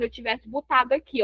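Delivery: normal talking voice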